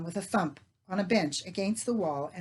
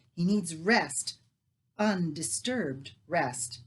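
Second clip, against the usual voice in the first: slow, pointed, each word leaned on; pointed emphasis; 3 dB louder, much slower than usual. Acted. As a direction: lean on undisturbed rest, slowly. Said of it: "she said with pointed emphasis".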